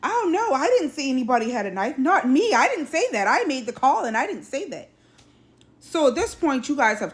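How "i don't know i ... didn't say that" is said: high-pitched, insistent mock denial; retold denial. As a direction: imitate a caller protesting, pitch jumping up. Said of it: "She yells".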